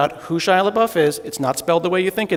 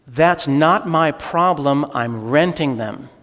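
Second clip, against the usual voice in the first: slow deadpan; slower than usual. Retold or imitated. British architect's calm retort quoted.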